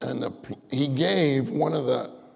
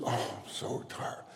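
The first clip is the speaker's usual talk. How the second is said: soft, slow tired mumble; silent weary complaint; 3 semitones lower, 11 dB quieter than usual. Acted. Preacher acts churchgoer's private thought in meeting, weary undertone. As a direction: grumble it under your breath. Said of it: "You don't say it out loud".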